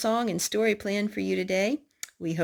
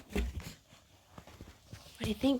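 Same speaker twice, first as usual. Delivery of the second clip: put-on soft whispered question to the child; hushed aside to a toddler; 3 semitones higher, 10 dB quieter, much slower than usual. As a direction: lean in and whisper it.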